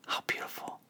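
hushed, breathless whisper; a soft whispered marvel; whispered aside. Real, not acted. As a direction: breathe it out in awe. Quiet aside in sermon.

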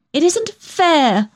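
high, drawn-out indignant protest; spluttering protest. A narrator's reading. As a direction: splutter at the unfairness, slowly and high. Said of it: "I spluttered".

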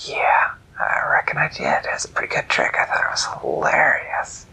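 a smug trickster gloating; hushed sly jackal. Acted. Jackal voice whispered.

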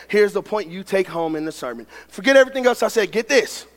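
usual speaking voice

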